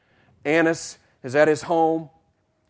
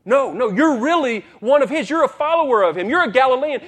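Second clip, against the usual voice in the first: higher and louder, pressing the accusation; insistent accusation; 10 semitones higher, 5 dB louder than usual. Acted. Bystanders insisting to Peter he follows Jesus, higher, louder accusation. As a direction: jab at him, you're one of them.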